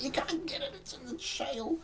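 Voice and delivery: high, hushed pretend whisper; soft, airy stage secret; mock-secret whisper. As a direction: whisper it as if letting slip a secret. Secret he should have kept quiet.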